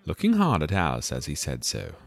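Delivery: ordinary delivery, nothing marked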